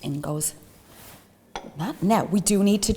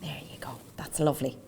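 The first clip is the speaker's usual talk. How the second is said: whisper; 7 semitones lower, 10 dB quieter than usual. Real, not acted. Quiet aside while mixing.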